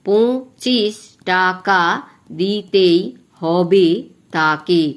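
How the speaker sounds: very slow, each syllable of the line drawn out; teacher counting the beats of the verse slowly for students. Shown as a demonstration. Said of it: "এইভাবে তোমরা মাত্রাগুলো আগে দিয়ে দেবে".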